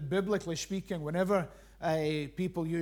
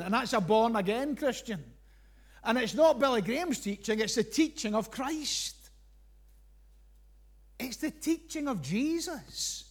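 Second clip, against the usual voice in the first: impassioned preaching; 3 semitones higher than usual. Acted. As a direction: preach it with rising conviction. Preaching at full force.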